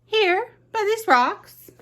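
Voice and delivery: a tiny mouse pointing out the spot; squeaky mouse. Acted. Mouse to the fox, a high squeaky answer.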